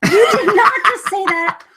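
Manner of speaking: high, loud, laughing disbelief; shocked laugh. Real, not acted. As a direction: squeal it out in amused shock. Wife reacts to being called winch.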